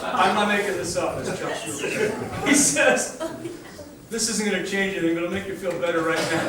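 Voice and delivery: the words break up into laughter as he retells them; laughing through it. Retold or imitated. Retelling his friend's kind words at the funeral, laughing.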